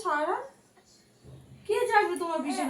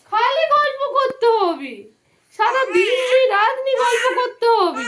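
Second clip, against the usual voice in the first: slow, loud, raised exasperated complaint; loud playful scolding; 5 semitones higher, 8 dB louder, slower than usual. Real, not acted. Mother scolding baby, louder.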